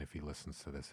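ordinary talk

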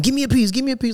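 high whiny mock begging; begging coworker. Coworker grabs at your food, high whiny mock begging. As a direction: mimic the food-grabbing coworker pleading.

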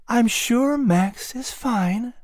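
high, slow, gentle mother voice; soft reassurance. A narrator's reading. Mother reassuring her injured son, softly. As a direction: soothe him slowly. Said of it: "Sarah said softly".

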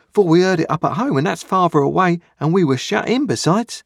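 put-on a small boy speaking up to a convict; young boy Pip. A narrator's reading. Boy Pip's voice.

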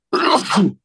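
sudden real sneeze; a sharp sneeze mid-broadcast; sneezing. Real, not acted. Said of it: "Estornudo, la gripe".

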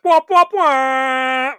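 mock trombone sting; mock fanfare. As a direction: sing a sarcastic sad trombone. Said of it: "And I was like".

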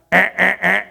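repeated short alarm buzzes; mock rejection buzzer. Security check refuses incoming anxiety, imitated buzzer.

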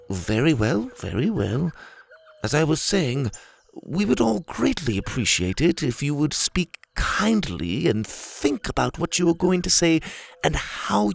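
high, patient gentleman; calm polite lecturing tone; prim orangutan voice. Acted. Leopold's voice.

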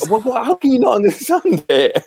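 exasperated. Acted. Brit misunderstood ordering water in a restaurant, exasperated. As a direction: protest in baffled, high-pitched frustration. Acted exasperation at not being understood.

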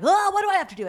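high, loud whiny complaint; mock whining protest. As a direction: act out a reluctant assistant objecting. Assistant balks at being sent upstairs.

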